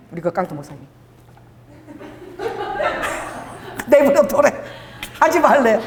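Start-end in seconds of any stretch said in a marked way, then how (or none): 2.4-3.4 breathy high laugh. Real, not acted. Laughing at the story.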